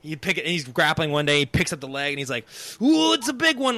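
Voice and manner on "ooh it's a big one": high, hammed-up kid hauling in a catch; mock fisherman excitement. Kid grappler picking up a leg like deep-sea fishing, imitated excitement. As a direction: whoop it like a deep-sea angler. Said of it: "Like he's deep sea fishing".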